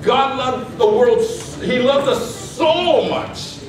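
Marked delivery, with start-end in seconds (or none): 1.6-3.6 "so" held very long, pitched up; stress on SO. Shown as a demonstration. Preacher emphasizing God's love to congregation, heavy stress on SO. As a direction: punch and stretch "so". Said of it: "you need to emphasize that".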